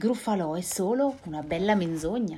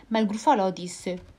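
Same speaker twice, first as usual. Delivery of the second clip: usual speaking voice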